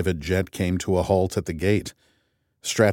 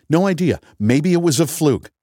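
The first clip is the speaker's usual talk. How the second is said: louder, higher snap back; heated retort; 8 semitones higher, 5 dB louder than usual. A narrator's reading. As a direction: fire back across the table. Grace heated argument.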